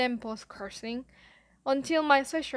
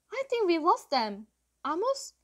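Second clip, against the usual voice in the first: higher voice, calling out; mild yell; 7 semitones higher, 4 dB louder than usual. A narrator's reading. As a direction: call to friends in the dark. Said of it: "someone yelled".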